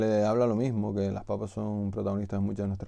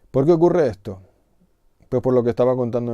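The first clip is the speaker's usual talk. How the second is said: indignant outburst; 2 semitones higher, 10 dB louder than usual. Real, not acted. Indignant rant about lost words.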